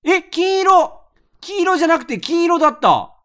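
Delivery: high, loud startled cry; a sudden real shock; surprised. Real, not acted. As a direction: yelp in real surprise at the colour. Surprised by the lottery colour.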